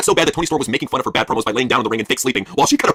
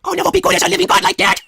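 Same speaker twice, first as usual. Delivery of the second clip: high, squeaky mock adoration; much higher mocking awe; mock worship. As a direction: gush like a groupie. Mock reply in character.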